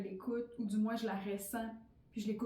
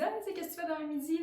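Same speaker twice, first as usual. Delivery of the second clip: casual retold invite; 7 semitones higher, much faster than usual. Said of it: "puis j'ai dit".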